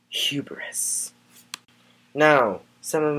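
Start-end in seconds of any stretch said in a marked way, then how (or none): 0.1-1.1 breathy whisper of one word; hushed, dramatic whisper; whispered reveal. Acted. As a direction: drop to a whisper for the big word.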